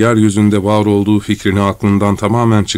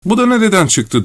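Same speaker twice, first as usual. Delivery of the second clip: much higher-pitched mock shout; high outcry without much volume; shouted protest; 11 semitones higher than usual. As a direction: exclaim like an indignant crowd. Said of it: "diye bağırdı herkes".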